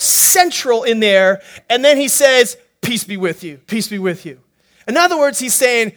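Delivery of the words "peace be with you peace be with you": lower, softer, steady; calm reassurance. Acted. Jesus soothing his frightened disciples, softly.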